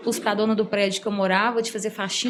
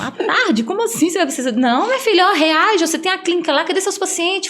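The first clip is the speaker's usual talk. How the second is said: friend scolding; 7 semitones higher, 4 dB louder than usual. Retold or imitated. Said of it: "E aí ele falou assim".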